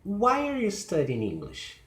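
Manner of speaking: high "Why", sliding down to "English"; falling question. Shown as a demonstration. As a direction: stress "Why", then descend. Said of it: "Information question".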